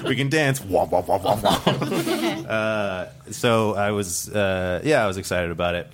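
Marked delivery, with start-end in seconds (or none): 0.0-0.6 higher, playful imitation of an invitation; mock coaxing. Replaying his date's pitch for the dubstep night, mock coaxing. 0.6-2.5 womp along as a silly joke, nearly laughing; mock dubstep womps. Riffing with friends on dancing to dubstep, mock womping. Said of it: "That was the noise [name] just made".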